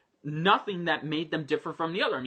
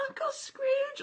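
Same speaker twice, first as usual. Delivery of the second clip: put-on very high, tiny little-kid squeal; squeaky-cute, pitched way up; cute baby voice; 4 dB quieter than usual. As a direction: mimic an adorable baby character calling out. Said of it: "she's not the cute baby".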